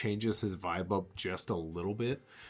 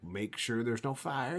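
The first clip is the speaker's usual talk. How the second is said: usual speaking voice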